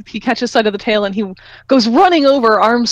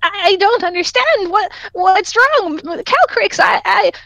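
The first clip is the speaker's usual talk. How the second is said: high, small pleading creature voice; plead, high and broken, slow on "what's wrong"; confused little creature; 11 semitones higher, slower than usual. Acted. Lizard pleads with the angry dragon, high and confused. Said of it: "you can hear him say".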